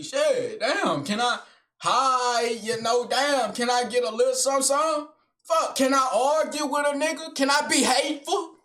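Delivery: high, loud, whiny begging voice; mock pleading whine. Mocking his own urge to argue, high whiny plea. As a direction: whine like craving a fight.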